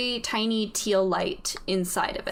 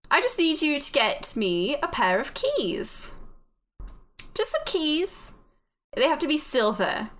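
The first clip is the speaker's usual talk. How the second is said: lilting put-on British, swooping up and down; bad British accent; 3 semitones higher than usual. Voice in the head assigns the player a simple errand, lilting British accent. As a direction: coax them in hammy British. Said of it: "My horrible British accent".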